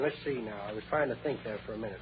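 quiet fast mumble to self; mumbling aside. Acted. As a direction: mutter it to yourself quickly. Mumbled aside while thinking.

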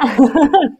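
real amused laugh; bright burst of laughter. Real, not acted. Laughs at own English.

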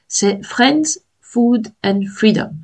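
Friends, Food and Freedom said with a put-on English accent. A cohost teasing her English accent, light English accent. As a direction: show off your best English accent on the three F words. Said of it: "On notera ce superbe accent anglais".

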